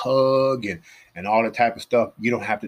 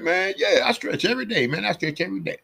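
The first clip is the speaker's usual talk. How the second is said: mock sheepish convert; 11 semitones higher than usual. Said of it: "he was like".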